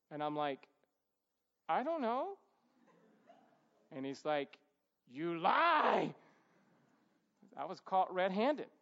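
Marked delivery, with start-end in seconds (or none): 1.7-2.4 put-on high little-boy fib; feigned innocence. Caught boy answering his dad, high mock innocence. 5.1-6.1 loud, drawn-out acted accusation; angry accusation. Dad catching his son red-handed, loud mock outrage.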